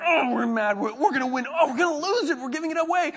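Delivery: high, loud, anxious sports-fan cry; excited retelling. Retold or imitated. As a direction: relive the game's swings, high and loud.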